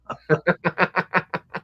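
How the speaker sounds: high, rippling laugh at own joke; amused burst of laughter. Real, not acted. Laughs at own Southern line.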